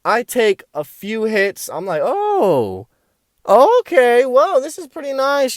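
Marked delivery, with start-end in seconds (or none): 2.0-5.6 drawn-out, high-pitched mock wonder; acted dawning amazement; pleasantly impressed. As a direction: stretch the oh, then marvel up high. Acts out feeling the hit.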